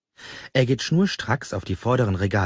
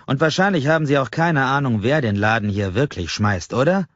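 taunting challenge; 6 semitones higher, 4 dB louder than usual. Acted. Customer taunts clerk.

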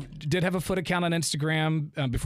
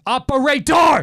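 loud, higher shout of operator; 10 semitones higher, 11 dB louder, slower than usual. Retold or imitated. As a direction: yell operator at the phone. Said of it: "over and over again".

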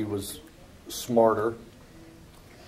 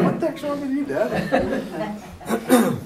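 high, laughing squeal at dad; laughing through an indignant son's outburst; mock outraged son; 13 semitones higher, much slower than usual. Preacher mocking the son's protest, laughing, high. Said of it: "You're like".

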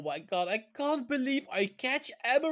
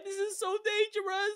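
normal talking voice

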